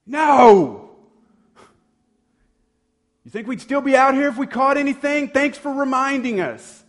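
high, loud, sarcastic yell; exasperated shout. Retold or imitated. Tired fishermen snapping at the voice from shore, loud and sarcastic. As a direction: bark back at the stranger, fed up. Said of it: "Imagine that reply".

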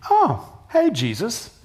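mock-casual, as if meeting a friend; casual greeting. Pastor imagining a calm greeting to Jesus on the lake, mock-casual. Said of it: "would your first thought be".

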